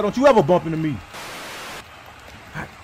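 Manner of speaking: ordinary talk, nothing marked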